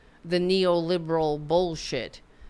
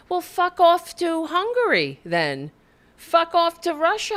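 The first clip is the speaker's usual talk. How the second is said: angry retort; 9 semitones higher, 4 dB louder than usual. Real, not acted.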